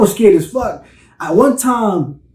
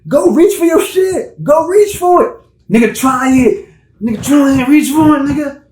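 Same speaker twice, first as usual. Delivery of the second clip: high, loud taunting cop impression; a hammed-up cop daring him to reach; mocking cop voice; 9 semitones higher, 4 dB louder than usual. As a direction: bait him like a trigger-happy cop. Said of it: "Y'all quick to be like".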